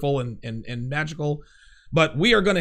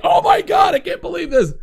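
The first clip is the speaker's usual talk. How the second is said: retold stunned shout, much higher and louder; 13 semitones higher, 7 dB louder than usual. Fan seeing Drago and Rocky in the ring, shouting in disbelief. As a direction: blurt it out like a stunned fanboy at the trailer. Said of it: "I was like".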